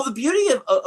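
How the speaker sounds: ordinary talk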